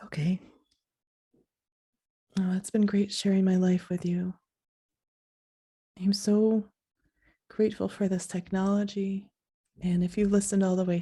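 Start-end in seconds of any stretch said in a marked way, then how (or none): none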